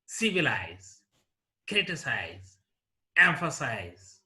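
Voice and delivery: "Civilize", "criticize", "emphasize" stressed at the start, then falling; first-syllable stress. Shown as a demonstration. Teacher repeating examples, first syllable stressed in "Civilize", "criticize", "emphasize". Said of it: "on the first syllable".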